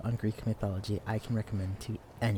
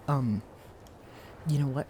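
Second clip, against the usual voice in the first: usual speaking voice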